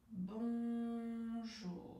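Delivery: BONJOUR very slow, each syllable held on one level note; stretched syllables. Shown as a demonstration. Coach leads stuttering exercise after a breath, drawn-out BONJOUR. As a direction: hold BON long, glide evenly into a long JOUR. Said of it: "allonger le mot, allonger la syllabe".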